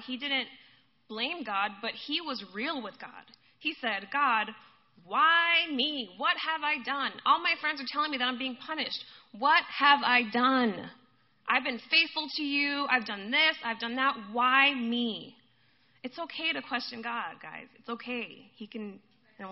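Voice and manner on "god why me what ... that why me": loud, raised-pitch protest to God; angry plea. Acted. Job complains to God that he is punished, angry plea. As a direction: demand answers, stretching the key words. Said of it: "He shouted at God".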